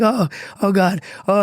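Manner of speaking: high-pitched imitation of officers cracking up; imitated amused disbelief; mock helpless laughter. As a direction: groan it high, as if barely coping. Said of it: "they'd start laughing".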